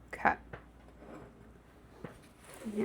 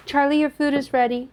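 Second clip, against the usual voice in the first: called out loud to another room; yelling across the room; 4 semitones higher, 7 dB louder than usual. Real, not acted. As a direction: call out so he hears. Calling Charlie to come eat.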